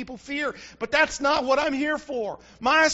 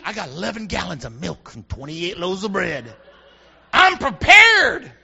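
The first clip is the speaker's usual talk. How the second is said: mock hoarder; 7 semitones lower, 4 dB louder than usual. Mocking hoarding shopper.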